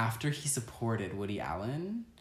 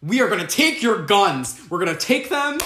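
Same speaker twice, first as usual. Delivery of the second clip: loud, high politician's speech; emphatic mock campaign pledge, voice raised; Beto O'Rourke; 8 semitones higher, 6 dB louder than usual. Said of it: "Is that Speedo O'Rourke?".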